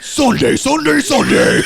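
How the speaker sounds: high, loud, drawn-out event-ad bellow; hammed-up announcer roar breaking into laughter; hype announcer. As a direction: shout it like an over-the-top rally promo. Mimics monster-truck style advert.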